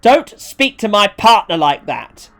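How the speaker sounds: indignant reprimand. Acted. First organiser defending a partner, indignant. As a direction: warn him off firmly, each word weighted.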